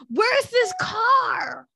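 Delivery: a slow, drawn-out shouted question; a hammed-up, stretched-out yell; mock shout. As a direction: call out "Where's this car?" in mock outrage. Retelling his empty offer.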